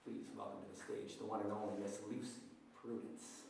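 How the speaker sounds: grand stage introduction; announcer. Acted. Host announces guest.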